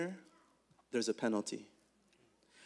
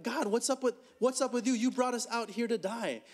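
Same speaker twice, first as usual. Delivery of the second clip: higher, indignant crowd voice; challenging God; 7 semitones higher than usual. Acted. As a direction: demand answers from God like the people did. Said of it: "They're, they're saying".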